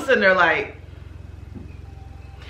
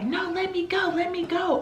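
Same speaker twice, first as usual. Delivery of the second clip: high-pitched, softer pleading whine; mock high protest; 11 semitones higher, 5 dB quieter than usual. Friend pulling away to join the fight, high whiny plea. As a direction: squeak out a struggling plea.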